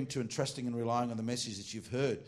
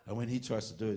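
ordinary delivery

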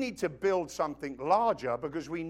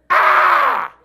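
loud breathy shriek of shock, higher; startled scream; 16 dB louder than usual. Retold or imitated. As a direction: gasp out a shocked scream as if seeing a giant. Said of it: "everyone goes".

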